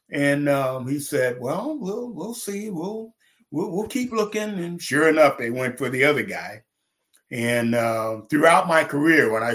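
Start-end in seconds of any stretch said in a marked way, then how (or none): 1.4-4.6 imitating a scout's higher, hesitant stalling; noncommittal hedging. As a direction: put him off gently in a raised pitch. Scout's noncommittal answer, retold.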